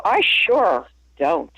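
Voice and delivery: slow drawled Georgia "I sure don't"; lightly imitating a Georgian saying "I sure don't", slowly; a hint of a Georgia drawl on "I sure don't", said slowly; Southern "I sure don't". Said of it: "But they go".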